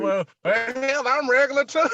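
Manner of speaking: loud, very high mock outrage; hammed-up insistence, loud and high; indignant comeback. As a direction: protest it at the top of your pitch, amused. Retold indignant comeback, laughing.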